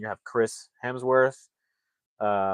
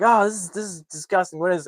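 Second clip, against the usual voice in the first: high, appalled squeal; disgusted; 9 semitones higher, 4 dB louder than usual. Retold or imitated. Couple shocked by the waiting line, high disgusted squeal. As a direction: recoil in disgust, pitched way up. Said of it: "they're like".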